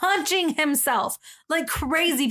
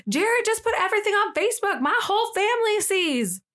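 high, panicked retold voice; panicked girl; 10 semitones higher than usual. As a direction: pitch it up like a frantic girl.

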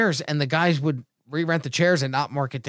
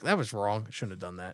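quiet; 8 semitones lower, 11 dB quieter than usual. Retold or imitated. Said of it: "I was like".

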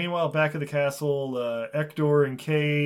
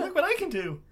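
squeaky squirrel voice; faster than usual. Acted. Playing as squirrel.

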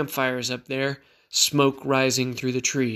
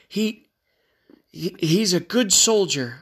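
higher, halting plea; faltering defence; 5 semitones higher than usual. A narrator's reading. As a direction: stumble while defending your fallen comrade. Faltering defence of dead comrade.

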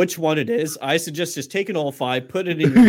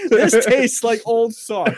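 laughing; 7 semitones higher, much slower than usual. Acted. Mock food critic line.

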